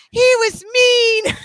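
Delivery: high, loud, drawn-out mock whine; whiny wail of a sore loser, hammed up; bitching kid. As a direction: imitate a crying kid complaining. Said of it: "like, bitching".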